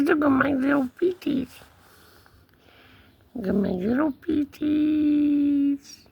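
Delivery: cat voice. Pretend. Cat showing off his feet, high cat voice. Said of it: "He says".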